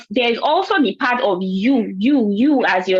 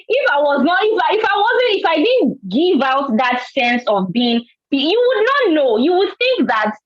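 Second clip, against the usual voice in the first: impassioned; 7 semitones higher than usual. Real, not acted. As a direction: pitch jumps way up, voice straining. Passionate point about showing fear.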